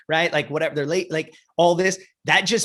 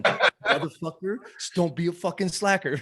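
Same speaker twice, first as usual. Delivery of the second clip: mock coach scolding; 4 dB quieter than usual. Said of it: "hear my fucking voice in your head".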